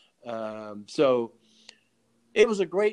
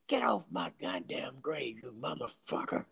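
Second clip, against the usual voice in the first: [name]; 8 dB quieter than usual. Retold or imitated. Dead trumpeter answering from his grave, lightly imitated. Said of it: "that's the way he talks".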